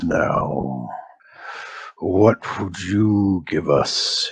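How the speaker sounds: low, slow shaman intoning; deep ritual orc priest. Acted.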